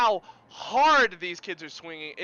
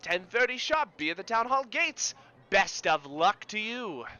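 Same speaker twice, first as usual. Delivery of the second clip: raised-pitch, theatrical carny send-off; carnival barker; 5 semitones higher than usual. Acted. Carny handing over a ticket to the mayor, hearty barker voice. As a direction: send the winner off with showman flourish.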